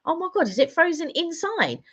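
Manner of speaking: high-pitched mock alarm; disbelieving, high storyteller's exclamation; retold dismay. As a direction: replay the shocked realisation, pitched right up. Retold alarm at frozen windscreen.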